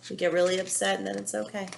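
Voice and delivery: ordinary talk, nothing marked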